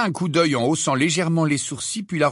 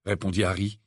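ordinary delivery

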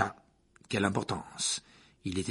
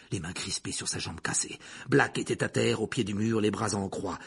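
ordinary delivery, nothing marked

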